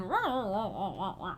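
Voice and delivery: wordless grumbly dog noises, falling away; playful impression of a grumbling dog; imitated dog grumbling. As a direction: grumble like a pushy little dog. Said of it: "my dog was grumbling".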